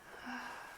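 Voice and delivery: soft, short exhaled sigh; demonstrated sigh. Shown as a demonstration. Yoga teacher showing listeners how to exhale, soft sigh. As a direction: let the breath out in a quiet sigh. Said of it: "exhaling maybe with a sigh".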